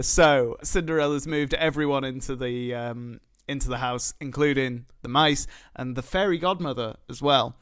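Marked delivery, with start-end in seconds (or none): none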